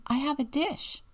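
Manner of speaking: put-on high-pitched small child; lightly acted child, pitched high=; little boy's voice. A narrator's reading. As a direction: pipe up like a little kid. Story reader voices little Robbie.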